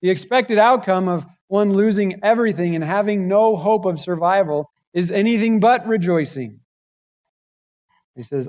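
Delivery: ordinary delivery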